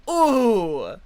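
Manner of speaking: long crowd "ooh", falling from high; audience "ooh". Acted. Game master voicing the audience near the fake punch, impressed ooh. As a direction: let out a loud, drawn-out ooh of awe. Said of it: "as the audience near you goes".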